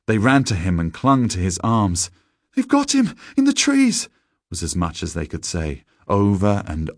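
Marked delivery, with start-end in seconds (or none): 2.5-4.1 put-on high, loud, panicked boys' wail; frantic high-pitched alarm; terrified cry. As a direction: cry out in horror to a rescuer. Terrified boys cry out to shepherd.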